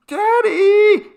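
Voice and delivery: high, very loud shriek for daddy; terrified scream. A narrator's reading. Carol screaming for her father at the ghost, shrill terrified scream. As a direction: scream for your father in terror. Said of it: "she screamed".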